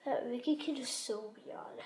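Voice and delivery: soft unvoiced whisper. Real, not acted. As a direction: whisper the gossip. Gossiping in the dark.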